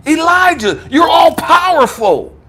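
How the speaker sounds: high, impassioned preaching cry; rousing affirmation. Retold or imitated. God reminds Elijah of his power, rousing proclamation. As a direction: lift the voice and proclaim it with force.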